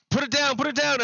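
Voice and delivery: high-pitched frantic order, imitated; acted urgent command. Acts out armed bystander ordering robber.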